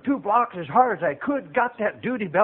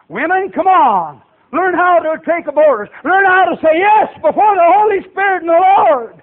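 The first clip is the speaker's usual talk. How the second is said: raised, loud pulpit delivery; impassioned preaching; 8 semitones higher, 6 dB louder than usual. Real, not acted. Preacher tells church of God making soldiers, forceful exhortation. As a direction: preach it with force, building to a cry.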